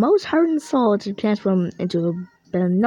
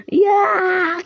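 dopey cartoon bellow; 9 semitones higher, 4 dB louder than usual. Cartoon heroes cheering.

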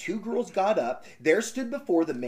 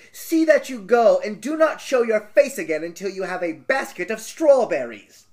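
wicked stepmother; 4 semitones higher, 6 dB louder than usual. Acted. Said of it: "said the stepmother".

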